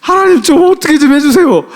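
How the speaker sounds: re-enacted crying prayer, high and loud; weeping prayer. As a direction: cry out to God, half laughing. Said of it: "제가 진짜 막 울면서 기도하면서".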